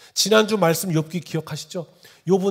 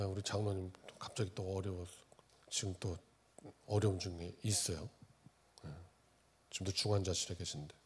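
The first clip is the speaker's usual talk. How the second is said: low, soft and subdued; hushed concern; 9 semitones lower, 11 dB quieter than usual. Real, not acted. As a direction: speak gently of someone gravely ill. Pastor on elder in intensive care. (낮고 조용하게 걱정하는 어조)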